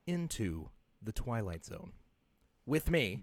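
low, hushed Twilight Zone narrator; spooky announcer voice. Shown as a demonstration. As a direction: drop low and soft like a TV host. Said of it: "as I should say it".

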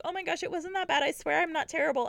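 high, pleading, embarrassed voice; mock flustered denial. [name] pleading with the editor, high flustered. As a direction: protest too much, high and flustered.

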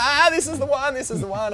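high, loud stoked yelling; frothing excitement. Retold or imitated. Surfer watching the wave of the day, frothing excited yell. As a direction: lose it with joy on the wave. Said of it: "and I was like".

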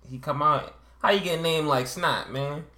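mock street storyteller with a dumb voice; dumb Baltimore street voice. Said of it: "his voice sounds dumb".

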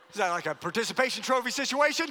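normal talking voice

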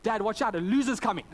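high, loud imitation of a child calling out; young son's warning. Son warning dad of another car, excited child voice. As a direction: mimic a kid sounding the alarm to dad. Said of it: "He said".

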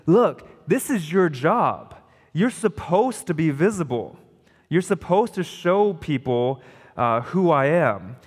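preacher voicing Jesus, slow and forceful; emphatic Jesus quote. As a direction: drive it home as Jesus insisting. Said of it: "it's really emphatic".

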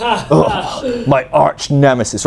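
slow, hammed-up villain greeting; mock dramatic. Greeting the pastor as his mock arch nemesis, slow and hammed-up. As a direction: savour the words like a melodrama.

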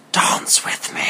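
breathy seductive whisper into his ear. A narrator's reading. Narrator whispering to Connor, seductive whisper. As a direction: whisper it close and low. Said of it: "I whispered".

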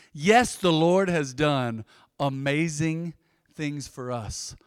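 softened slow reading of the verse; scripture reading. Acted. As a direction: let the verse sink in slowly. Reads verse three very slowly.

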